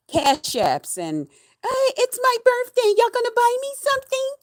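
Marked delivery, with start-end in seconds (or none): 1.6-4.3 very high-pitched imitation of her begging; mock begging. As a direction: squeak it out, mocking her plea for gifts. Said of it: "she's on here begging y'all".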